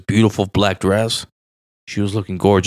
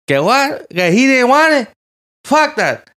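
mock outrage; 14 semitones higher, 4 dB louder, slower than usual. Retold outraged rant about cook.

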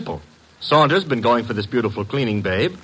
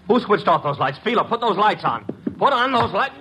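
alarmed calling out; 9 semitones higher than usual. Acted. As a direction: pitch climbing higher with each call. Lights go out, Archie calls out.

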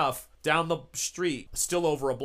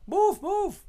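high, loud shout; shouting move; 6 dB louder than usual. Acted. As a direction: shout the crowd out of the way. Ambulance crew shouting at crowd.

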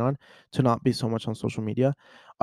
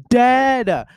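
"Dead!" high, loud and drawn out; emphatic repeat; 11 semitones higher, 8 dB louder than usual. Real, not acted. Movie fan retelling the twist to viewers, "Dead!" high and loud.

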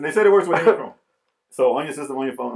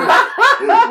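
squealing burst of laughter; high laugh; 6 dB louder than usual. Real, not acted. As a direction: let out a high cackle. Cracking up.

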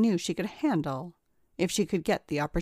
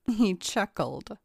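usual speaking voice

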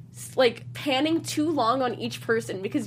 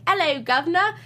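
higher-pitched caricature Cockney greeting; mock Cockney accent; 6 semitones higher than usual. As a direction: put on a hammy Cockney accent. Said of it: "like this Cockney dialect".

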